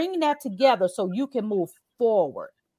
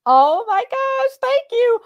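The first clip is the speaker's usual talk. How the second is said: high, slow, delighted thank you; delighted surprise; 13 semitones higher, 7 dB louder, slower than usual. Real, not acted. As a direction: gasp of delight at the offer. Delighted at offer.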